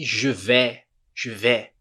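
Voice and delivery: "je vais" as zhuh veh; French "vais" ending on an open vowel; silent S. Shown as a demonstration. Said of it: "Não falo vais".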